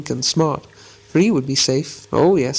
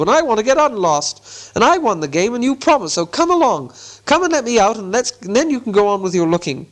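higher, insistent call; crying out; 8 semitones higher than usual. A narrator's reading. Bilbo demands the promised way out, crying out. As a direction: urge it in a raised, high-pitched voice. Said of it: "cried Bilbo".

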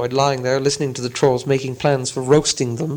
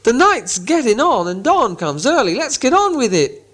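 impatient troll; 9 semitones higher than usual. Acted. Trolls urging each other on, high impatient troll. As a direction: hurry them along. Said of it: "said Tom and Bert".

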